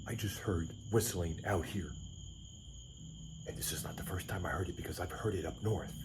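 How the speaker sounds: whispering. Real, not acted. Whispering outside at night.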